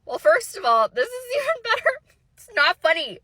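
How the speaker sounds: high, loud, breaking into laughter; laughing protest. Real, not acted. Teller scolding a giggling listener, laughing and high. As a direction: protest through your own giggles.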